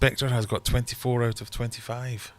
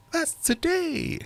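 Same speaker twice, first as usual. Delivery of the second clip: much higher and slow; squeaky high voice; much slower than usual. Pretend. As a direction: squeak it like a cartoon. Joking aside about Valentine's.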